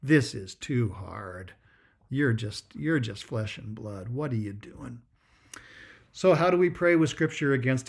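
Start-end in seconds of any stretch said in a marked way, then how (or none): 0.0-5.0 a hint of a tempter murmuring, lower and softer; the noonday devil coaxing a tired monk, slow; tempting devil, quiet. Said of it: "tell them".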